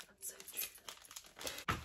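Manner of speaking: slow, barely voiced whisper; hushed, too moved to voice it; overcome whisper. Real, not acted. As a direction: whisper it, deeply touched. Moved by the box's message.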